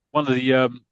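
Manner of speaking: normal talking voice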